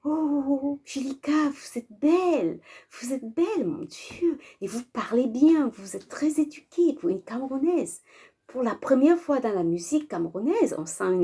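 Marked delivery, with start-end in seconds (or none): 0.0-8.0 higher, sugary flattery from a smooth-talking admirer; imitating a man laying on the compliments; gushing admirer, voiced higher than her own; flattering man. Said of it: "Il me dit, euh".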